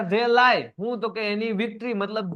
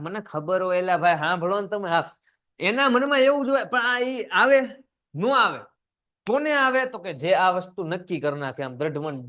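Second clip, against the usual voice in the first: ordinary talk, nothing marked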